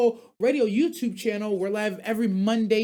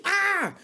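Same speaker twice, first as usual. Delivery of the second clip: disgusted yell; 11 semitones higher than usual. Real, not acted. Disgusted outburst after gagging.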